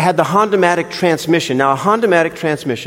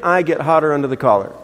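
usual speaking voice

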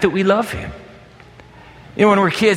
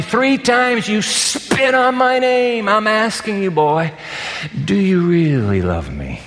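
higher, slow, searching plea; pleading Jesus; 5 semitones higher, slower than usual. Acted. Jesus asking Peter on the shore, slow pleading. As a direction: press him gently, again and again. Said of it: "Yo, Peter".

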